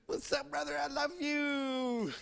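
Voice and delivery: high slow mocking sweet voice; sing-song mocking love; teasing trickster. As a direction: tease your big brother. Sklep teasing the bear.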